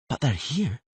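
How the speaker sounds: lightly voiced girl muttering; mild mutter as Ciri. Ciri objecting to Geralt, a mild mutter. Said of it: "she muttered".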